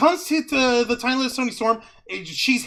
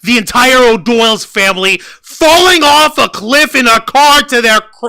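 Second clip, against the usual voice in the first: ordinary delivery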